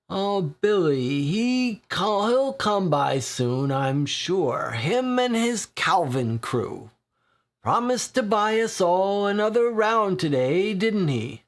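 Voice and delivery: a dry regular perking up over a free pint; lightly voiced weary old drinker; thirsty old drinker.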